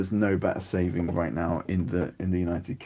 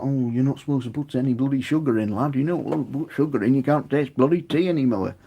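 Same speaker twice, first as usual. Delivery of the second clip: higher, sneering mock northern snob; snobby tea purist; 6 semitones higher, 4 dB louder than usual. Mocking tea snobs who scold people about sugar, higher sneering voice. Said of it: "don't let people be snobby about".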